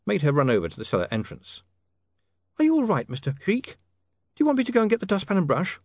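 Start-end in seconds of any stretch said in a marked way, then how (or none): 2.6-5.8 put-on high, bright young lady, quickly concerned; a polite young woman calling down to a crashed workshop; Saccharissa's voice. Young lady calling down, worried.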